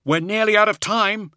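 high, loud cry of warning; urgent cry. A narrator's reading. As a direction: call out urgently over the din. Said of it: "he cried out".

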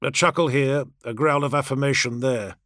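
ordinary delivery

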